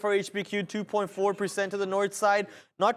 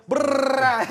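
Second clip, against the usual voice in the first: a loud, high rolling brrr of glee; loud lip-trill brrr; 5 semitones higher, 6 dB louder than usual. Real, not acted. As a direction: trill your lips loudly to celebrate the falling market. Celebrating short as market drops.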